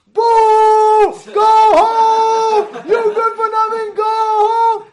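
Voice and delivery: high, loud drawn-out boos; jeering crowd. Retold or imitated. Crowd jeering the mayor out, loud high booing. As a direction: heckle at full voice like a hostile rally. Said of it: "all of a sudden, you hear".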